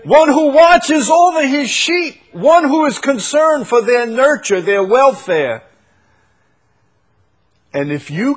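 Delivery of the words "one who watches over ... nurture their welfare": full preaching voice. Acted.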